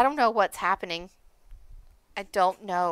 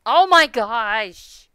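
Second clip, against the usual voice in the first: slow, loud oh my gosh; drawn-out dismay; 3 semitones higher, 12 dB louder, much slower than usual. Real, not acted. Gamer groaning at a game mishap, slow and loud. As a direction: stretch it out in dismay.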